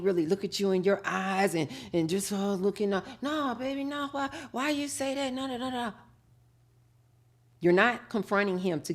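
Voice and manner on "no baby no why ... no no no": lightly voiced denying man. Man denying, shifty eyes.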